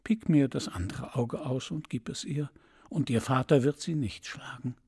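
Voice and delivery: ordinary delivery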